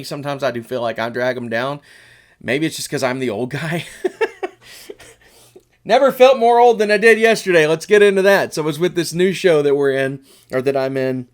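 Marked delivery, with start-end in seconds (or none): 3.9-5.0 high breathy laugh. Real, not acted. Laughs at being the old guy. 5.8-9.8 excited. Real, not acted. Excited lead-in after laugh.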